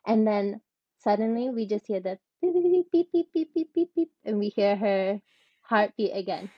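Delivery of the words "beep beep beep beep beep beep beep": high imitated monitor beeps. Imitating the heart monitor returning.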